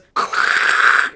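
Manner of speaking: a breathy mock snort-laugh. Retelling Ariana mocking Thea, imitated snorting laugh. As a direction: imitate her snickering snort. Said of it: "and snorts and starts laughing".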